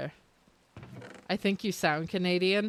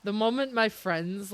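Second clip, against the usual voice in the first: normal talking voice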